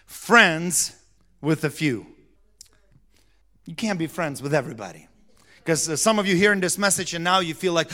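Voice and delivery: usual speaking voice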